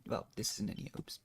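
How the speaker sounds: ordinary talk, nothing marked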